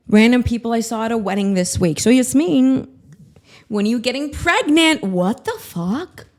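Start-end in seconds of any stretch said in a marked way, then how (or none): none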